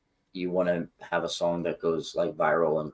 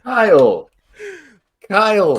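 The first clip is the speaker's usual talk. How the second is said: loud, high calls of his name; shocked scolding; 13 semitones higher, 10 dB louder than usual. Real, not acted. Co-host loudly scolding his partner for the swear word. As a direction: call him out in disbelief.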